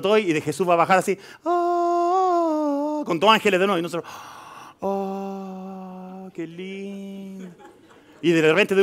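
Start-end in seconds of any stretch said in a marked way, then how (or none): 1.4-3.0 high mocking gasp of wonder; mock awe gasp. Preacher mimicking believers gazing at the sky, high mock gasp. 4.8-7.5 soft, very slow dreamy coo; mock dreamy awe. Preacher mocking believers waiting for a pretty sight, soft slow coo.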